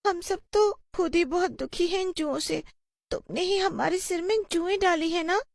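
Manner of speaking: put-on very high, small child voice; timid pupil. A pupil asks the witch about the lice, high and aggrieved. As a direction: plead and accuse in a high child's voice.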